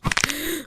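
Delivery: sharp intake of breath; high, startled gasp. Real, not acted. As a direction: gasp in shock at the scene. Reacting to a creepy game scene.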